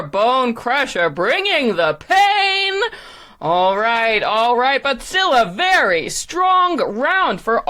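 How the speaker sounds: big, swooping ringside announcer voice; showy contest announcer. Acted. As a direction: sell the suspense to the arena crowd.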